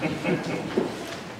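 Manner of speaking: thin, strained weak voice. Real, not acted. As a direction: speak through a tired, weak voice. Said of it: "my voice is weak again today".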